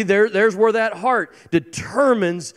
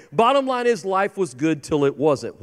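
normal talking voice

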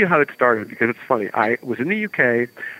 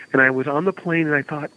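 ordinary talk, nothing marked

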